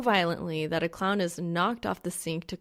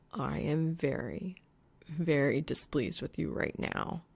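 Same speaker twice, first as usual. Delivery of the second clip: growling; 3 semitones lower, 4 dB quieter than usual. A narrator's reading. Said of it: "he growls".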